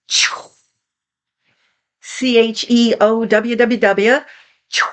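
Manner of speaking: rushing chewww sound, rolling out; chew like a wave breaking. Shown as a demonstration. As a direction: let the chew crash like a wave. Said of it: "like a wave breaking".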